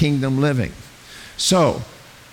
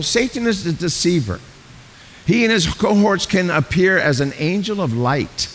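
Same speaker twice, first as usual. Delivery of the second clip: ordinary talk, nothing marked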